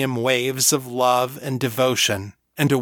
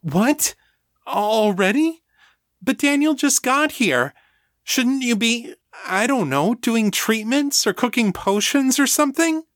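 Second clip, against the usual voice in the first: alarmed protest; 12 semitones higher than usual. Acted. Rebecca protests to Artax, high and alarmed. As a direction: blurt it out in alarm, voice jumping high. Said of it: "straighten up in alarm".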